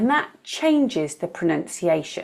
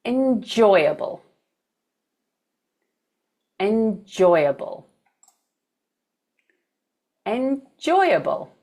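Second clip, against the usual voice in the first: enJOYable, slow, with JOY stressed; second-syllable stress; slower than usual. Shown as a demonstration. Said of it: "stress is on the second syllable".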